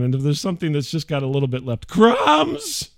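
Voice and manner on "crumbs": high mock shout of crumbs; mock yell. Father and son mocking the wife, shouting. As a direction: imitate her yell jokingly. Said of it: "my son and I walk around".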